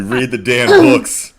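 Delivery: high, slow mock scolding; mock stern teacher. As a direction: scold them like a strict teacher.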